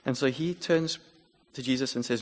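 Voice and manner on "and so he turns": ordinary talk, nothing marked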